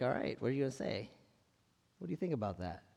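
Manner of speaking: quick, quiet playful teasing challenge; little tease. Retold or imitated. Jesus teasing the woman, quick and quiet. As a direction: tease her lightly, a quick quiet dare. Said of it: "Kind of a little tease".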